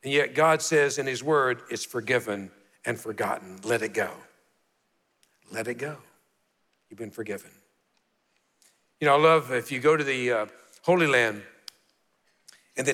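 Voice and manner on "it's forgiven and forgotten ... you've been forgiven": soft voice of God. Acted. God's words voiced softly.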